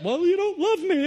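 wife firing back at her husband, high and wounded; hurt wife. Acted. Acted marital quarrel, wife.

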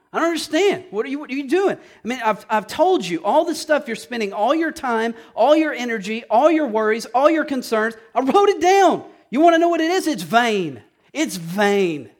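high, loud exasperated divine rebuke; voicing God exasperated. Pastor voicing God rebuking the congregation, exasperated and loud. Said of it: "you would think God'd say".

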